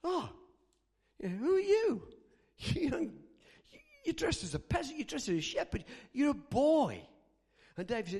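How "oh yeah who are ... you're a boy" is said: high, loud incredulous reaction; dismayed disbelief. Acted. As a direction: size up the boy in raised-pitch dismay. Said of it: "Saul's heart drops".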